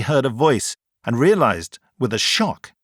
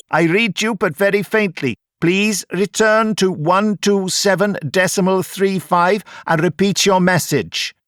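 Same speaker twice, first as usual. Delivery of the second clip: radio operator; 8 semitones higher, 4 dB louder than usual. A narrator's reading. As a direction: call it out carefully over the radio. Warner on radio.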